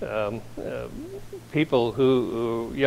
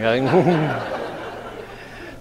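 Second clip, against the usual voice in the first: laugh. Real, not acted. Author laughing.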